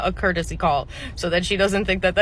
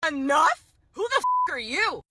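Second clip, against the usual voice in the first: high, incredulous question; indignant challenge; 7 semitones higher than usual. Acted. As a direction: throw it back in outraged disbelief. Customer challenges the manager.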